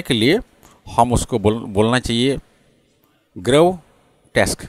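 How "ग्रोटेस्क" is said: ग्रोटेस्क slowly split into two syllables, gro and tesk; ग्रोटेस्क drawn out with a break before tesk. Shown as a demonstration. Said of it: "दो syllable है".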